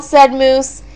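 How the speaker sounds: plain reading voice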